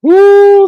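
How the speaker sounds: a loud, high-pitched woo; loud high whoop. Real, not acted. Sudden exclamation.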